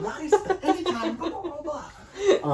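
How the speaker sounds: high, laughing replay of the rant; laughing mock rant. Replaying the scene line for the friend, laughing. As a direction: re-enact the line while cracking up. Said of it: "that was already too much".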